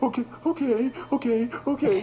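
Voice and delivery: put-on high, jittery okays from a scared kid; imitating a frightened child; nervous kid.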